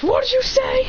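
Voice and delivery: high-pitched affronted question; indignant disbelief. Acted. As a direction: squawk it high, offended. Indignant reaction to ship name.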